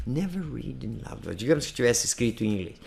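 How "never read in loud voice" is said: soft English practice line; hushed, careful reading in English; low voice practice. Shown as a demonstration. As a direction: rehearse it quietly to yourself. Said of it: "treinar em voz baixa".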